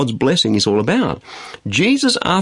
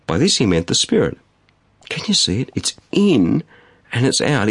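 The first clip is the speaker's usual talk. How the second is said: ordinary delivery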